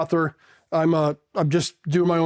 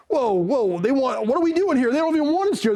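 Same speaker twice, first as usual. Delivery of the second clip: climbing higher with each phrase, mock alarm; retold alarmed disbelief; 8 semitones higher than usual. New Marine reading hostile graffiti at airport, rising mock disbelief.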